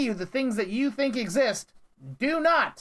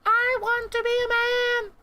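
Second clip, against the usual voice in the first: much higher put-on voice; mock Stuart; 13 semitones higher than usual. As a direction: parody the line in a silly high voice. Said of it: "Sounds like Stuart".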